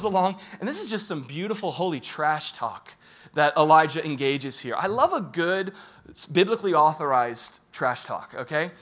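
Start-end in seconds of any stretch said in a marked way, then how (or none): none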